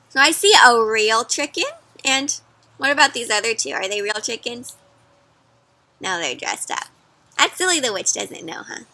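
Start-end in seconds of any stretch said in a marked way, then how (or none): none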